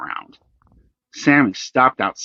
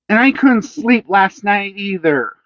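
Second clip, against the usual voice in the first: put-on high and louder, sulking boy; angry child; 10 semitones higher, 4 dB louder than usual. A narrator's reading. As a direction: keep the anger going. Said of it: "said Sammy angrily".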